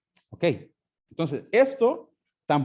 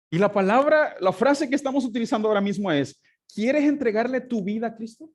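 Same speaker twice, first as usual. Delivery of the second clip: normal talking voice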